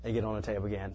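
usual speaking voice

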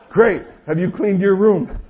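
loud, high, pointed demand; exasperated dad. Retold or imitated. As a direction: blurt it out like a fed-up father. Dad cutting through the excuse.

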